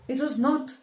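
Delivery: insist, leaning hard on not; rare emphasis. Acted. Father defends the mother to his daughter with firm emphasis. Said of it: "he said with rare emphasis".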